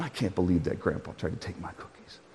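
low, hushed mock grumble; huffing and puffing. Woman storming off from grandpa, huffing and puffing quietly. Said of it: "she's huffing and puffing".

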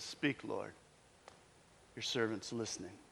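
a soft, humble whisper to God; hushed reply. Acted. Samuel answering God softly in the dark.

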